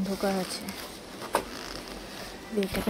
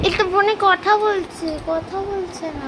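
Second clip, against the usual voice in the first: high teasing call; 8 semitones higher, 6 dB louder than usual. Real, not acted.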